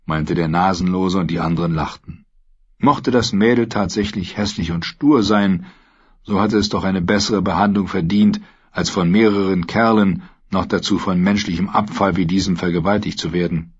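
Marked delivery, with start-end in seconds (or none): none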